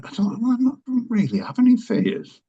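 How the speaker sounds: breezy, high-pitched brush-off; high, casual claim of no fears; retold denial. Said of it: "And I said".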